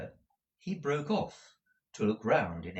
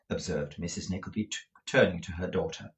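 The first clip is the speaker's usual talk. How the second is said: plain reading voice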